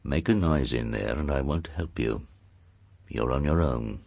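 low, soft warning; undertone. Acted. Lord warning his hidden niece, in an undertone. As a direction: warn under your breath, deep and hushed. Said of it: "spoke in an undertone".

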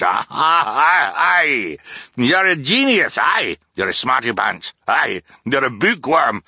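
overexcited captain gushing praise at Klaus; boisterous sea captain. A narrator's reading. Captain character voice.

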